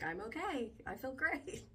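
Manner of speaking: lightly hammed-up cheerful quote; mock upbeat relief. Acting out her predicted recovered self.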